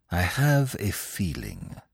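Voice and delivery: slow, soft and grave; slowly. Acted. Poirot to Hastings, a slow, soft foreboding. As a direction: let the premonition creep out slowly. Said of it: "he said slowly".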